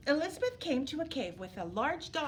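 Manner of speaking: ordinary delivery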